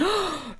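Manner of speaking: a sharp high gasp, like a shocked crowd; imitated crowd gasp. Shop crowd reacting to the shove, sharp high gasp. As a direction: gasp the way the whole shop did. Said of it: "everyone went".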